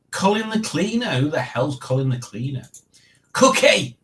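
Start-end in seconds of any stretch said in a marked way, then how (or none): 3.3-4.0 excited cry. Real, not acted. Reading a name excitedly.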